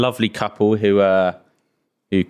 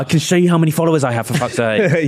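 fast, higher, cocky mock brag; mock show-off; 5 semitones higher than usual. Lost in London, mocking himself asking strangers for a phone, fast cocky brag. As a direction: rattle it off like a smug influencer.

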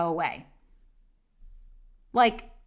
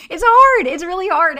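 loud, higher, exasperated cry of it's hard; 8 semitones higher, 11 dB louder than usual. Real, not acted. Host venting to listeners about dating, loud exasperated cry. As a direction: throw it out like a groan of frustration.

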